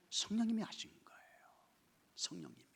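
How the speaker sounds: softly whispered conviction; hushed pulpit aside; intimate whisper. Acted. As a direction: lean in and whisper it. Sudden whispered emphasis.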